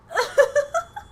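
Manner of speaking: short rising squeal of laughter; high laugh. Real, not acted. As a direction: giggle up high. Laughing after outburst.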